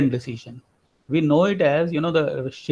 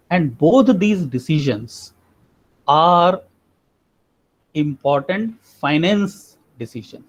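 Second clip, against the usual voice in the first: slow, deliberate, leaning on finance; stress on finance; slower than usual. Shown as a demonstration. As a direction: give the word finance a falling push at the end. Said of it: "I'm emphasizing on the word finance".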